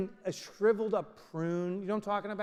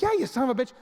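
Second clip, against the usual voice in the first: mock tough reply; 6 semitones higher, 5 dB louder, faster than usual. Answering the toothpaste.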